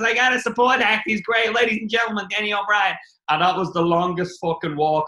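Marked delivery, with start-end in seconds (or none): 0.0-3.0 higher, louder mock compère voice; hammed-up big introduction, higher pitch; imitating Bill introducing. Comedian retelling Bill announcing him, raised announcer voice. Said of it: "He goes".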